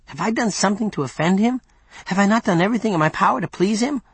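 high, hurried, questioning voice; plaintive servant. Acted. Yonk asking Mark why Sarbo dislikes him, plaintive high questions. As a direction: plead to know what you did wrong.

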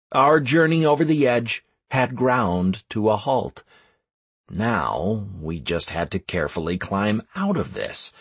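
ordinary delivery

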